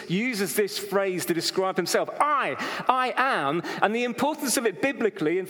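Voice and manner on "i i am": "I, I am" slow, higher, each word weighted; emphatic statement. Shown as a demonstration. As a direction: hit "I" hard, then a firm "I am". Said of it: "It's emphatic".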